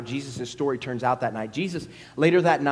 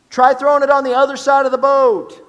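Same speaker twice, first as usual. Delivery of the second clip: high, loud shouted advice; Jesus calling instructions to the boat; loud call from shore; 11 semitones higher, 12 dB louder than usual. Acted. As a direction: call it across the water.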